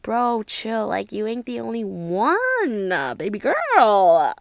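drawn-out, hammed-up put-down; sassy mockery. Mocking him for getting attached, sassy. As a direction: stretch the words out, teasing and cocky.

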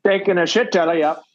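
high, heavy mock Scottish accent. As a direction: bark it like a Scottish soldier. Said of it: "In a Scottish accent?".

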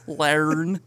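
Australian "alone" squeezed into "learn"; Australian accent. Retold or imitated. Host repeating the funny word to friends, mock Australian accent. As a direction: imitate the R-coloured Australian vowel in "learn". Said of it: "either a Y or an R in it".

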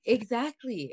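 normal talking voice

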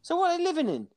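high, squeaky puzzlement; baffled questioning. Real, not acted. Friend quizzing the fish owner, high baffled voice. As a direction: ask it high-pitched, utterly baffled. Baffled follow-up about guppies.

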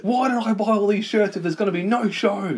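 high-pitched hammed-up whine; mock worry. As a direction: complain in a silly put-on voice. Voicing the host's worry.

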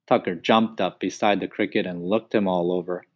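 usual speaking voice

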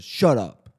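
short, forceful mock dismissal; hypothetical curt command. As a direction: try out a firm, low put-down. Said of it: "what if I was just like".

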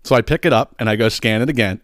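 usual speaking voice

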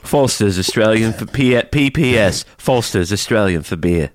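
slow Aussie beer-ad parody; hammy bogan Australian; mock Australian accent. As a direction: drawl it like an Australian commercial. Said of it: "We sound like a couple bogans".

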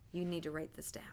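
whispered aside to the class. Real, not acted. Teacher whispering to students to write it down. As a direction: drop to a whisper to tell them to take notes.

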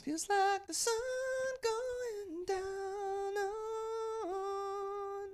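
soft, high crooned ad voice; breathy sung commercial jingle; insurance commercial. Acted. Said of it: "Was that like an insurance commercial?".

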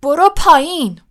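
loud, high-pitched furious command; angry shout. A narrator's reading. Ron yelling at Crookshanks in the tree, angry shout. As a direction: shout the order out in anger. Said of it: "رون با خشم فریاد زد".

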